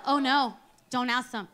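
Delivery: low, soft, snide gossip voice; snide churchgoer muttering about a smelly sister; gossiping church member. Retold or imitated. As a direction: drop low and whisper the gossip. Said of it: "they're like".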